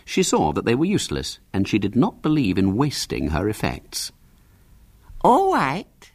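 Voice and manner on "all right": put-on very high, louder little-girl voice; shrill but composed six-year-old; Violet Elizabeth Bott. A narrator's reading. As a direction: agree in a piping child's voice, untroubled. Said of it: "she said calmly".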